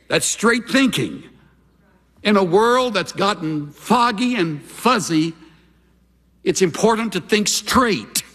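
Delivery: high, insistent pulpit voice; preacher getting carried away; worked-up preaching. Real, not acted. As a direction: raise the pitch and drive it home. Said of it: "Why am I yelling?".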